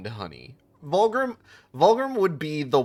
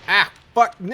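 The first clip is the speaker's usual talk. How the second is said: frustrated outburst; 9 semitones higher, 8 dB louder than usual. Real, not acted. Failing a bit in game.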